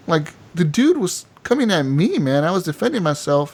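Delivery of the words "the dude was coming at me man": usual speaking voice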